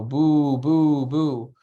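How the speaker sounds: loud, drawn-out boos; mock crowd booing. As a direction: boo like a jeering wrestling crowd. Acts out the jeering wrestling crowd.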